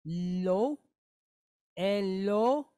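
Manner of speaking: Lo then Elo built syllable by syllable; sounding out. Shown as a demonstration. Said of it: "pointed Cholem".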